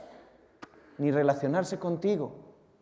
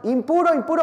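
high, louder call of impuro; leper's shout; 9 semitones higher, 5 dB louder than usual. Pretend. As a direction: cry out a warning as you walk in. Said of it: "tenía que ir gritando".

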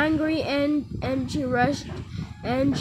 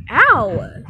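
mock ow; 2 semitones higher, 8 dB louder than usual. Slapped in pretend play.

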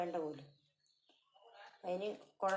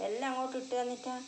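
mock scolding pet; 5 semitones higher, 5 dB louder, faster than usual. Real, not acted. Scolding cat over food.

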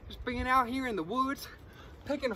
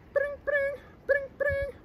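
high sing-song bring bring; phone ringing; 13 semitones higher, slower than usual. Pretend. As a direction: ring like an old telephone.

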